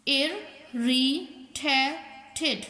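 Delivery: irritated in four slow beats, ir, ri, ta, ted; teacher sounding out ir—ri—ta—ted; split into syllables. Shown as a demonstration. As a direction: say irritated with a gap after every syllable. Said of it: "चार vowel sound देते हों".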